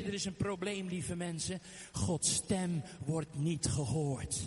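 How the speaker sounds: ordinary talk, nothing marked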